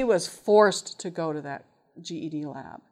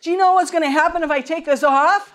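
higher, louder protesting student; indignant teen; 9 semitones higher, 8 dB louder than usual. Retold or imitated. Teen student protesting to his teacher, higher and louder. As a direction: complain like a defiant teenager.

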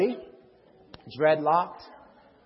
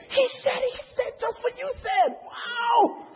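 very high squealing wonder; thrilled amazement; slower than usual. Retold or imitated. Newcomer to the guy that brought us, squealing in amazement. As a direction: squeal in hammed-up astonishment.

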